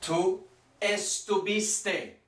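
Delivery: estuviste said very slowly, syllable by syllable; slow modelling. Shown as a demonstration. Teacher to class, a tricky form: very slow model. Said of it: "Little tricky".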